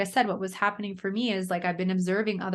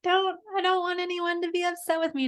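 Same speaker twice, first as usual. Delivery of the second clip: put-on much higher, tiny child's anxious plea; high, fretful child voice; little Amber pleading; 11 semitones higher than usual. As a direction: squeak it out like a worried little girl. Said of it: "she's just like".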